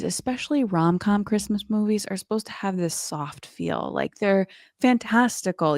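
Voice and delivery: ordinary talk, nothing marked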